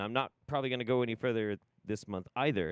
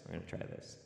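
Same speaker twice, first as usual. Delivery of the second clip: whispered; 5 semitones lower, 10 dB quieter, faster than usual. Shown as a demonstration. Repeat dropped to whisper.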